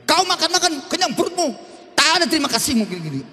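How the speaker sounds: high, fast nagging wife voice; mock scolding wife. Wife scolds her husband for never thanking her, high fast nagging. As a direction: rattle it off like a fed-up wife.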